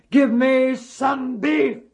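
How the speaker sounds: slow, loud booming giant; giant's demand. Acted. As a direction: boom it down from the sky. Giant speaks from sky.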